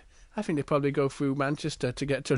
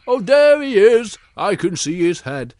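excited discovery; 4 semitones higher, 7 dB louder than usual. Acted. Searchers spot Little Bear.

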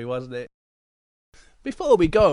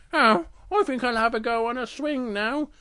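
higher-pitched cub thinking aloud; Little Bear voice; 6 semitones higher than usual. Acted. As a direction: muse in a small bear's voice. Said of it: "And then Little Bear thinks".

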